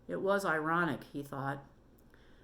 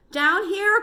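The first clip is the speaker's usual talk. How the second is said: high, loud call upward; 11 dB louder than usual. Acted. Derek calls up to the boys with the light. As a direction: call up the hole to them. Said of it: "called Derek".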